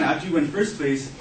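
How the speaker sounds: usual speaking voice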